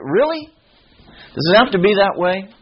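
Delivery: high-pitched, quick sceptical question; incredulous challenge. Real, not acted. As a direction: question it in rising disbelief. Challenging a common church cliché.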